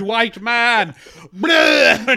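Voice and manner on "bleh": loud mock retching bleh; vomit noise. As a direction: gag loudly like throwing up.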